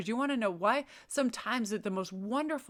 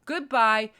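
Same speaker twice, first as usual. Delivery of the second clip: a louder, abrupt "Goodbye!" thrown out; 3 semitones higher, 9 dB louder than usual. Pretend. Telling a bad partner off with a loud, emphatic goodbye. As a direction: cut them off with a loud, cheery farewell.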